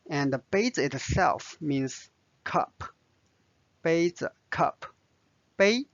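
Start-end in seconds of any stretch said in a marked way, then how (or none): 5.6-5.8 杯 held level in first tone. Shown as a demonstration. Teacher isolates 杯 for learners, level first tone. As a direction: say 杯 alone with an even first tone. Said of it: "first tone".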